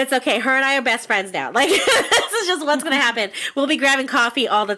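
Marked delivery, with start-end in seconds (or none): 1.5-3.3 laughing. Real, not acted. Joking they're best friends now.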